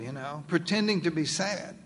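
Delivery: ordinary talk, nothing marked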